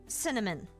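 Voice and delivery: ordinary talk, nothing marked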